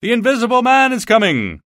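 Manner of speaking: high, loud, panicked cry; terrified shout. Acted. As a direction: scream the warning as you flee indoors.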